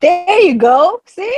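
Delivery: There you go, high and drawn out; proud teacher cheering; delighted praise. Real, not acted. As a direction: cheer him on, slow and high. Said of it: "praising his attempt".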